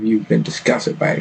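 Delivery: usual speaking voice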